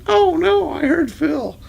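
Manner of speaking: very high squeaky little ant cry; tiny ant voice. Retold or imitated. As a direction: pitch it way up like a panicked tiny ant. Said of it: "little buddy ants going".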